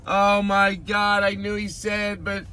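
very high, drawn-out squeals; hammed-up hysterical excitement. Fan calling to Elizabeth about being talked about, hammed-up high shrieking. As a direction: squeal it slowly in disbelief, calling out.

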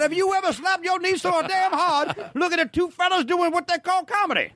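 high twangy Alaskan hillbilly; mock Alaskan hillbilly, pitched high. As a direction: do a backwoods Alaskan local. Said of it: "sounded like Billies in Alaska".

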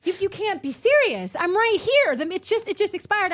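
high, loud indignant pleading; retold outraged plea; exasperated protest. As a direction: argue in disbelief, voice climbing. Arguing with the meter maid, retold.

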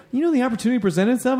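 high, quick quoted justification; defensive retold excuse. Retelling a friend's excuse, high quick mimicry.

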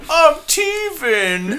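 put-on high, slow whiny baby voice; baby talk. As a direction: whimper it like a teething baby. Zombie baby voice, teething.

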